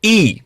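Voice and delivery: "ઈ" alone as the vowel of ink, pitched high; phonics vowel sound. Shown as a demonstration. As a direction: model "ઈ" as a sound, not "આઈ". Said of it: "ઉચ્ચાર તમારે યાદ રાખવાનો". ("ઈ"નો phonics ઉચ્ચાર, આઈ નહીં)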